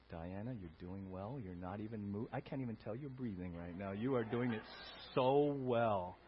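soft mock praise; hushed teasing compliment. Preacher teases a very still congregant, soft mock praise.

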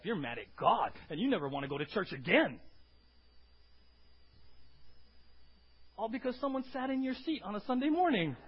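escalating sermon shout; rising preacher shout. Acted. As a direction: drive it home with rising force. Preacher building to loud point.

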